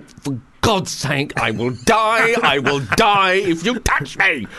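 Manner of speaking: high, loud, slowed pleading; mock terror. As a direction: beg them not to touch you, half-hammed fear. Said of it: "And you're going".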